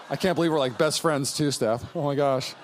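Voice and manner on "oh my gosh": ordinary delivery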